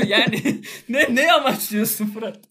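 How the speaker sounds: loud, high, laughing through the words; laughing protest. Real, not acted. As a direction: protest to your co-host while cracking up. Cracking up at co-host's jab.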